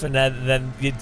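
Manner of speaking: ordinary talk, nothing marked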